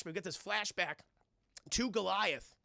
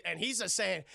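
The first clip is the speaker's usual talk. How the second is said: normal talking voice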